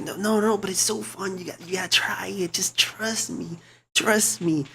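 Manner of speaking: normal talking voice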